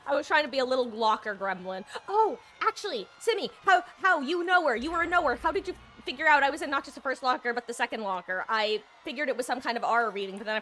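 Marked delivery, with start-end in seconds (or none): 2.1-6.7 excited. Real, not acted. Surprised at being found by Timmy.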